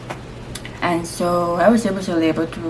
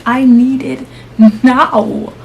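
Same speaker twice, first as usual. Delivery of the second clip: loud, raised re-enacted demand; urgent demand; 7 semitones higher, 9 dB louder, much slower than usual. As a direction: insist on relief, punching it out. Repeats demand for pain relief.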